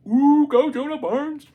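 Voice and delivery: high, slow, loud cheer; excited cheer. Real, not acted. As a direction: cheer him on with delight. Cheering on the translator.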